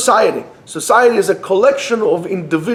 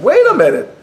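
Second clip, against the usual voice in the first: sudden realisation; 10 semitones higher, 3 dB louder than usual. Acted. Speaker catching himself mid-lecture, suddenly surprised. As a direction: pitch jumps high on a startled "wait".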